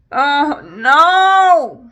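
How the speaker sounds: loud, high wailing oh no; real anguished cry. Real, not acted. Vlogger watching her foundation go patchy, wailing in dismay.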